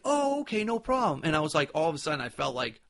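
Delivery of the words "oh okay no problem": high mock reply. Retelling Dave's casual reply.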